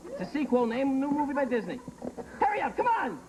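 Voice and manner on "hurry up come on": high, urgent prodding; impatient urging. Pretend. Host rushes contestants for an answer, high impatient urging. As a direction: hurry them along in a raised pitch.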